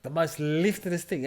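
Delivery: ordinary delivery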